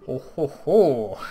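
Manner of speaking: slow, loud spelled-out ho-ho-ho; an acted old elder's belly laugh; elder's hearty chuckle. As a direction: chuckle slowly and loudly, each ho separate. Village elder's chuckle, read aloud.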